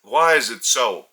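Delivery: normal talking voice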